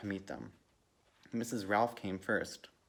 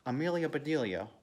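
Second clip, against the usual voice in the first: higher, moderately raised scolding; angry shout; 6 semitones higher, 3 dB louder than usual. A narrator's reading. Mister Rogers to his maid, angry shout. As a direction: call the name out crossly. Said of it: "he shouted".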